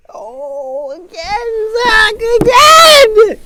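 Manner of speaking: long drawn-out shout; frustrated yell. Real, not acted. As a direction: yell it out in disbelief.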